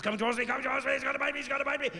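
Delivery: high, frantic rush; hammed-up fright as it closes in; mock panic. Panicked hunter tale.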